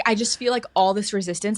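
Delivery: ordinary delivery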